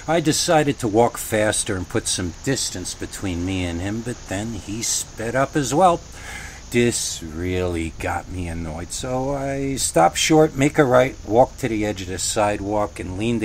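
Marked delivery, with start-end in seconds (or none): none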